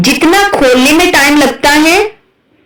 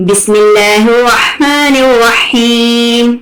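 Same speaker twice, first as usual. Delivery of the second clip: slow, drawn-out recited Bismillah; Quranic recitation; much slower than usual. Acted. Teacher opening the class, slow chanted recitation. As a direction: intone it slowly like a qari.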